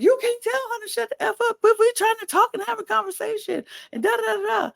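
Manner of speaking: high, fast mock squeal; imitating Kayla. Podcaster imitating Kayla scolding Leah, high fast mock voice. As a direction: rattle it off in a shrill put-on voice.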